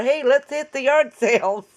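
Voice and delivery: normal talking voice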